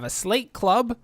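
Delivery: ordinary talk, nothing marked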